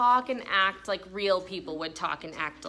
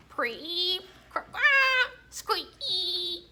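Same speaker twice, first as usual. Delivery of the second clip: frog call noises; 6 semitones higher, much slower than usual. Acted. Imitating frog calls again.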